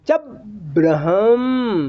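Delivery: "चप" short, pause, "Brahma" long; stop, then lift gently. Shown as a demonstration. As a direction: step over a gap after "चप", ease slowly into "Brahma". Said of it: "धीरे से उसको पाँव उठा के चलिए". ("चप" पर रुककर "ब्रह्म" धीरे खींचें)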